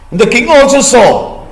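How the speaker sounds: plain reading voice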